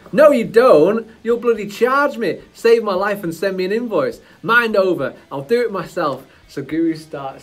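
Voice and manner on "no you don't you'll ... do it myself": louder, higher, indignant character; loud, higher indignant protest; indignant refusal. Acted. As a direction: refuse the greedy guru's help. Acted seizure scene.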